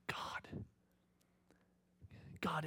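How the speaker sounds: usual speaking voice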